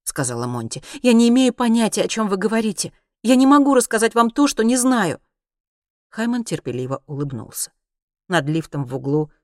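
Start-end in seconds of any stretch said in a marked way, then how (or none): 1.0-5.2 raised, insistent plea; pleading protest. A narrator's reading. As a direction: plead to be believed, voice pitched up. Prisoner pleads with interrogator. (умоляюще)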